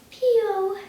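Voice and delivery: little beast calling out; creature's chirp. A narrator's reading. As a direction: peep it like a small creature. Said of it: "said the furry thing".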